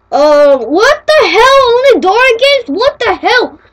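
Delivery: loud, high, slow disbelief; outraged. Pretend. User outraged at finding only Dora games, loud and appalled. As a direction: demand an answer, appalled.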